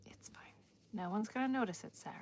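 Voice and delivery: whispered self-reassurance; hushed muttered it's fine. Real, not acted. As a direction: say it to yourself with no voice. Reassuring herself under her breath.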